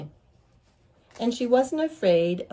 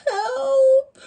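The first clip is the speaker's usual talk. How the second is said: high, loud, tearful wail; crying cry for help; 10 dB louder than usual. A narrator's reading. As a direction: wail for help through tears. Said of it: "sat down on a rock and cried".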